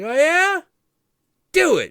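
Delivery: very high, loud shrieked dare; screaming dare. Retold or imitated. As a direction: scream the challenge at full force. Boy daring Shiva.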